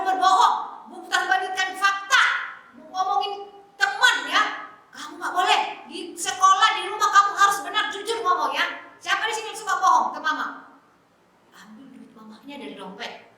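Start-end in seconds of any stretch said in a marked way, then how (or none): none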